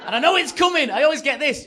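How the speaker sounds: high, loud exasperated outburst; exasperated shout. Acted. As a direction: shout it, seeing the jibe coming. Exasperated anticipation of heckle.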